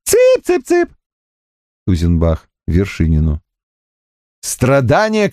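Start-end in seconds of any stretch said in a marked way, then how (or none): 0.0-0.9 mock chick call in a thin piping voice; тонким голосом. A narrator's reading. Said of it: "Солёный тонким голосом". (тонким писклявым голосом, дразня)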